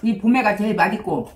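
speaking between spoonfuls; words after a bite; talking while eating. Real, not acted. Seen 0: Holds spoon, sips at 3.5s, keeps eating from bowl throughout.

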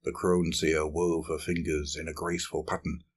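ordinary delivery

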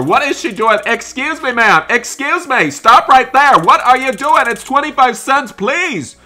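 high-pitched frantic protest; exasperated, high acted yelling; frantic shouting. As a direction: shout to stop a rampaging customer. Shouting at customer wrecking store.

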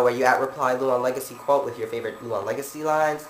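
usual speaking voice

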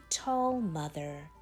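ordinary delivery

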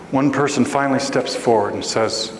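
usual speaking voice

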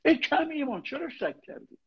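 high, sharp rebuke to a doubter; Christ rebuking. Retold or imitated. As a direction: scold him from above, pitched high. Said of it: "مسیح داره میگه".

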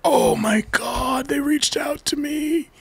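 high, slow, drawn-out squeal; reenacted amazement. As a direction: gush in a very high voice, stretched out. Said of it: "And I was like".

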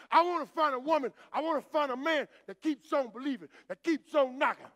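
full-force preacher's call; preaching at full force. Acted. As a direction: whoop it out to the church.

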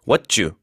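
"What you" as "wha-tchoo"; fuse "What you" with a tense ch; linked t-y as jju. Shown as a demonstration. Teacher showing shortened question, "What you" with a 쭈 sound. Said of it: "쌍지읒 소리를 내면서 쭈".

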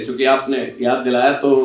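usual speaking voice

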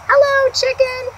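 high greeting as Duck; cheerful duck greeting. Acted. Duck greeting Chicken, high and cheerful. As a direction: sing out a hello up high.

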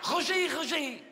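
slow Belgian French, rising high at the end; hammed-up lovestruck Belgian accent. Oula declares love to Roger, Belgian accent. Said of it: "c'est mon accent belge".

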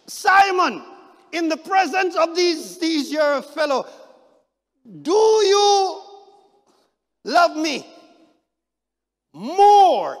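high, loud, drawn-out plea; loud pleading question. Acted. Jesus asking Peter before the others, shouted plea. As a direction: press him hard with the question.

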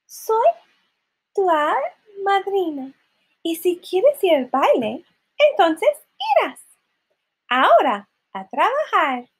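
high, sing-song fairy voice; fairy godmother. Acted. Fairy godmother promising Cinderella the ball, high voice. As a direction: announce it high, in short phrases.

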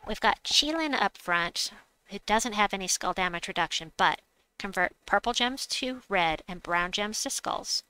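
ordinary delivery

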